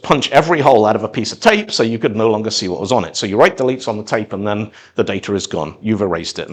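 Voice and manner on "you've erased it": ordinary delivery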